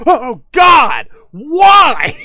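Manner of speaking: shrieking in sudden fright; yelp, startled and loud; scared shout. Real, not acted. Jump scare in horror game.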